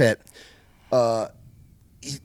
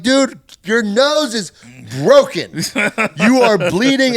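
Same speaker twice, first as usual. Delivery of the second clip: high, loud, slow warning; alarmed shout; 7 semitones higher, 7 dB louder, slower than usual. Retold or imitated. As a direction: shout it at a bloody friend. Telling the bleeding guy.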